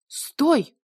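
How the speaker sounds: high, loud shout. A narrator's reading. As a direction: yell stop in panic. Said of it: "крикнула она".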